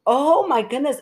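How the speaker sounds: high-pitched mock shock; shocked mother, imitated. Boyfriend's mother finds the couple in bed, imitated in high surprise. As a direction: gasp it out as the flustered mother.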